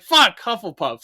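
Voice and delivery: loud, high, slow outburst; mock outraged yell. On getting Hufflepuff, a mock outraged yell. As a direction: yell it at the quiz result. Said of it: "and I'm like".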